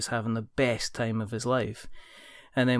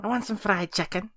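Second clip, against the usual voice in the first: high-pitched put-on Scouse shout; Scouse accent; 10 semitones higher than usual. Shown as a demonstration. Practising the accent on a chicken order, Scouse accent. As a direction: call it out in mock Scouse. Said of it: "to get into the Scouse accent".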